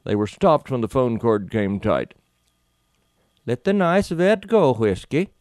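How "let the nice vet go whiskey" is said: lightly voiced Scandinavian accent, higher; a hint of Scandinavian lilt. A narrator's reading. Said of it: "she said in a Scandinavian accent".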